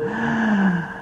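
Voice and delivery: soft, high, drawn-out sigh of contentment; quiet happy child's sigh; contented sigh. Retold or imitated. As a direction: sigh like a toddler melting into a hug. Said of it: "and she'd go".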